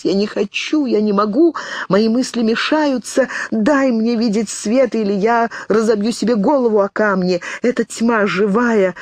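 high, panicked pleading; frightened girl clinging to her sister in the dark; trembling babble. A narrator's reading. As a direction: plead as if shaking with fear. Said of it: "лепетала она, вся трепещущая".

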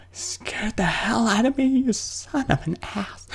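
breathy unvoiced relieved whisper; shaken whisper. Real, not acted. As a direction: whisper it, still shaking. After jump scare.

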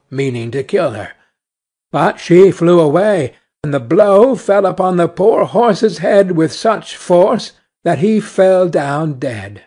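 plain reading voice

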